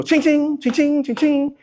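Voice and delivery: high sing-song phone chime, "ching, ching"; imitated cha-ching. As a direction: ring it out like a cash register, high and light. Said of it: "walk around your phone and go".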